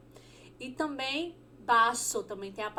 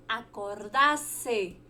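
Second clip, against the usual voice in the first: slow Spanish word; much slower than usual. Shown as a demonstration. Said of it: "Acordar-se em espanhol".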